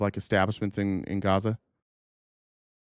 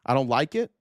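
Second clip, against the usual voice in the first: incredulous echo. Retold or imitated. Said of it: "That's it?".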